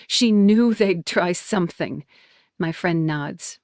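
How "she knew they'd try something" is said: usual speaking voice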